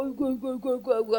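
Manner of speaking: imitating a dad's soft cooing; kindhearted dad soothing. Soft-hearted dad comforts his crying child, imitated soothing murmur. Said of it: "아빠는 너무 마음이 좋은 사람이라".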